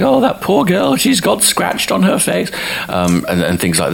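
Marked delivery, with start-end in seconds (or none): 0.0-2.5 very high mock-concerned caller; squeaky imitation of a worried caller; worried phone-in caller. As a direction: imitate a fretting viewer, voice pitched way up. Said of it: "people were phoning in and stuff saying".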